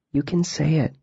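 soft, coaxing and tender; gently. A narrator's reading. Girl coaxing a grieving boy to speak, gently. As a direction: ease the words out of him. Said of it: "Ari said gently".

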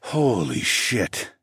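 slow, drawn-out groan. A narrator's reading. Narrator overcome by his lover, groaning. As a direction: stretch the words into a groan. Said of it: "I groaned".

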